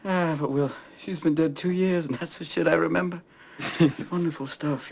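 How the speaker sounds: high voice left over from hard laughing; laughing reminiscence. Acted. Widower recalling his wife dead two years, still laughing.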